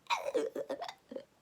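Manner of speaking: unvoiced gasping sob; breathless sob gasp. Retelling the heroine crying atop the stairs, gasping sob. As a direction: gasp for air between anime sobs. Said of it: "you know that anime crying".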